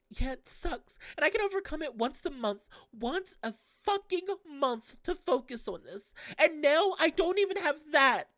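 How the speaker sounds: put-on high girl's voice, bitter and rising; Tsitsi lashing out. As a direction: snap back at him as the hurting girl. Tsitsi complaining.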